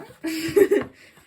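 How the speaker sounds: loud high laugh; loud, high laughter at her own fib; burst of laughter. Real, not acted. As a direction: laugh out at the joke.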